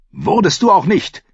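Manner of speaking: high, sharp retort; snapping back. A narrator's reading. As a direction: fire it back at him, pitched high. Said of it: "fauchte Renly zurück". (gereizt zurückfauchen)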